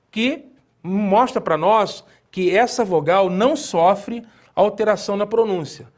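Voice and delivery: ordinary delivery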